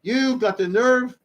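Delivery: how dare you, drawn out high; wife confronting her brother-in-law, slowly and in outrage; indignant wife. Retold or imitated. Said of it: "the wife of this one said like this".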